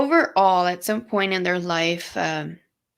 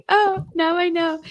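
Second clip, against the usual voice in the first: high, laughing mock realization; 11 semitones higher, 5 dB louder than usual. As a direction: squeak it out while laughing. Continues the playful mock reply.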